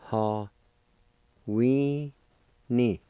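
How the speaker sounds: slow teaching pronunciation; syllable-by-syllable demonstration. Shown as a demonstration. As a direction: separate syllables with long pauses, pitch lifted on "we". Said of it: "First part is pronounced like this".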